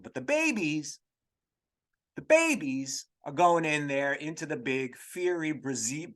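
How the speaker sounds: ordinary delivery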